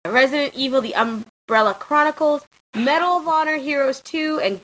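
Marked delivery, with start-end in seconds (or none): none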